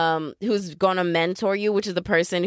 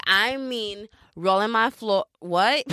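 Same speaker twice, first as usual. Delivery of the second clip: ordinary delivery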